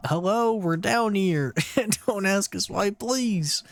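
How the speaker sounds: high, slow call up the shaft. Acted. Stuck in the well, calling up to strangers. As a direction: call out to anyone above, hopeful.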